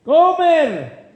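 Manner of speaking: desperate husband shouting for his wife; calling out. Acted. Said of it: "Empezó a gritar".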